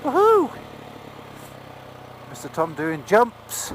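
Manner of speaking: ordinary talk, nothing marked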